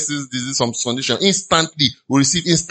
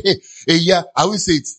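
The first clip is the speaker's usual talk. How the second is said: normal talking voice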